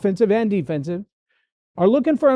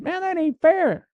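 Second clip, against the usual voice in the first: much higher, slow sulky whine; sulky complaint; 10 semitones higher than usual. Retold or imitated. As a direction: drag it out like a sulking teammate. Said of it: "in the form of an attitude".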